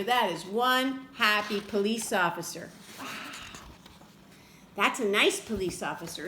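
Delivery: ordinary delivery, nothing marked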